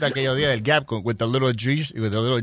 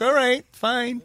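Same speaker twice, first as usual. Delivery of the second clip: mock casual; 9 semitones higher, much slower than usual.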